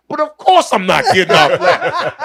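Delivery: hammed-up selfish protest; mock indignant rant. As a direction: rant in disbelief, half laughing.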